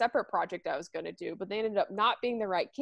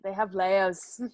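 botched attempt at Shrek's accent; lightly put-on, off-target Shrek accent; failed Shrek accent. Friend quoting the onion line, bad Shrek accent. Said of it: "what that accent was supposed to be".

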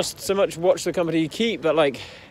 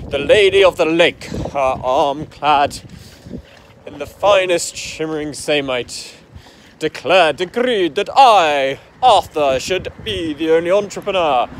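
loud, grandiose Arthurian declamation; mock-epic decree; 7 dB louder than usual. Mocking the idea of God-given talent, mock-epic decree. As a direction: proclaim it like a legend, hammed-up and booming.

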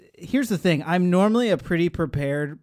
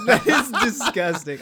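laughing; 8 semitones higher than usual. Real, not acted. Reacting to pouring sound, laughing.

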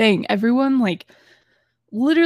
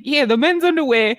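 put-on Boston accent; 4 semitones higher, 3 dB louder than usual. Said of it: "He's like".

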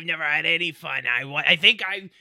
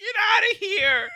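crying shout; 12 semitones higher than usual. Acted. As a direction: yell through mock tears. Acted sobbing shout.

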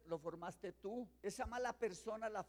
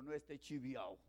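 lower, laughing through a joke; 8 semitones lower, 5 dB quieter than usual. Retold or imitated. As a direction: chuckle out the punchline. Joking about fake Rolex. (diciendo el chiste entre risas)

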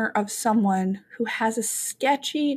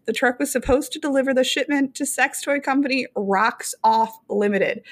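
ordinary delivery

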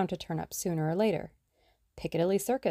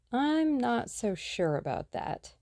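slow, higher musing reply; thoughtfully; 7 semitones higher, slower than usual. A narrator's reading. Tuppence to Tommy, pondering the clue, thoughtful and slow. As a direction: turn the idea over slowly. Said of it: "replied Tuppence thoughtfully".

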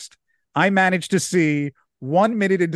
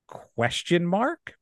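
deadpan question; 3 semitones higher, much slower than usual. Retold or imitated. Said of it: "Jared puts his pencil and just goes".

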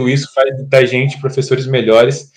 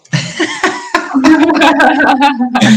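high burst of laughter; 13 semitones higher than usual. Real, not acted. Laughs after colleague's joke. (gargalhada aguda e alta)

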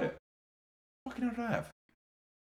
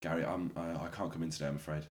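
much lower and softer, sombre; mock grief; 10 semitones lower, 7 dB quieter, faster than usual. Calling boss Gary, mock grief-stricken and hushed. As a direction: sound bereaved on the phone. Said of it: "I'll do it properly".

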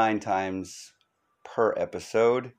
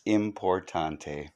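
importante said the French way, soft and slow; mock French accent; 3 semitones lower, 7 dB quieter, slower than usual. Joking aside to listeners in a mock French accent. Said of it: "Or as they say in France".

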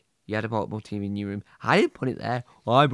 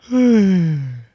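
loud, long waking sigh; long morning stretch of a sigh; 6 semitones higher, 10 dB louder than usual. Acted. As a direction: sigh out loudly as if just waking. Waking after a night's sleep.

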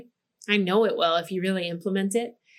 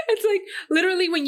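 laughing; 8 semitones higher, much faster than usual. Real, not acted. Laughing through words.

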